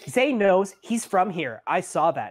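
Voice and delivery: put-on high-pitched little girl piping up; child character chiming in; Evandra. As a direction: pipe up eagerly, pitched high. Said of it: "Evandra kinda pipes up".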